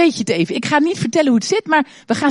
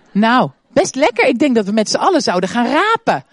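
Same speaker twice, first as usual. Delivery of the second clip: ordinary talk, nothing marked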